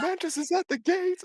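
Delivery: high shrill alarm yell; guard shouting a warning to the city; townsfolk yelling. Acted. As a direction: raise the alarm at the gates. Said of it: "You hear somebody yell".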